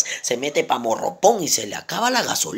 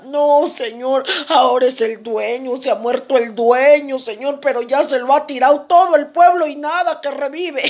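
high, raised complaining voice; animated lament; 8 semitones higher, 4 dB louder than usual. Acted. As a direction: pour out the bad news in agitation. Piurano se lamenta, remate del chiste. (lamento exaltado, en tono agudo)

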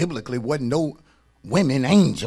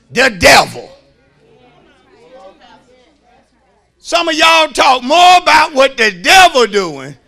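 rising preacher's call, louder and higher; loud preaching; 8 semitones higher, 12 dB louder than usual. Acted. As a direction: build the point up for the congregation. Preaching at full force.

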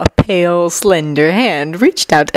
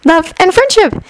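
high-pitched, pleased announcement; eager fortune-teller; 12 semitones higher than usual. Acted. Shinobu tells Haruhi what the cards mean, high and eager. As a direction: pronounce it high, like good news.

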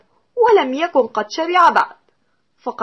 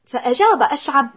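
ordinary delivery

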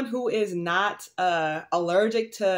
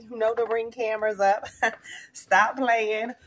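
ordinary talk, nothing marked

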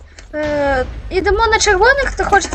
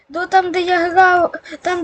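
ordinary talk

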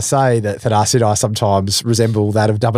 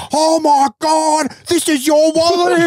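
very high, drawn-out mock amazement; astonished cry; slower than usual. Friend finding he had the wallet all along, astonished. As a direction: squeal the discovery slowly, hammed up.

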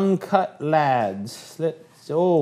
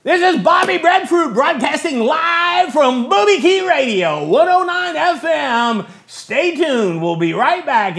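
loud, high radio announcer pitch; chipper 1950s ad; 8 semitones higher, 5 dB louder than usual. Acted. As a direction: sell it like a peppy old-time DJ. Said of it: "I gotta go on the air".

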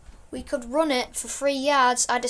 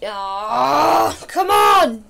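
loud cheer; 7 dB louder, much slower than usual. Real, not acted. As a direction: push yourself with a yell. Urging himself on.

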